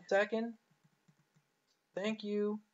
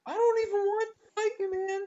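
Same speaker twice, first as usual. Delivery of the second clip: pitched way up, pleading; high pleading whine; 14 semitones higher, 5 dB louder than usual. Real, not acted. Pleading with an enemy player, high whine. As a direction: beg him not to fight.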